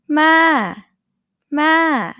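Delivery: "Ma" high and loud, then falling; high female fall on "Ma". Shown as a demonstration. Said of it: "Falling for female speakers".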